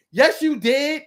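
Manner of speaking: loud, high comeback; retold argumentative retort. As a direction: mimic someone insisting in an argument. Retold argument, other person snaps back.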